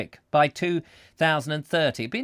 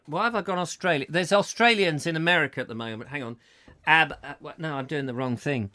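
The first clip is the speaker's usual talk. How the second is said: usual speaking voice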